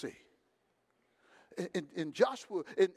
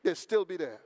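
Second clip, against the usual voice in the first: ordinary talk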